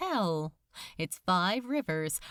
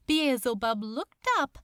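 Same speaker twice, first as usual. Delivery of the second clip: ordinary delivery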